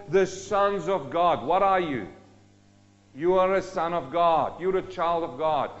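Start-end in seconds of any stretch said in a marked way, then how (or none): none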